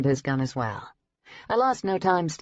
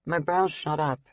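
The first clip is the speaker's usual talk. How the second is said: ordinary delivery, nothing marked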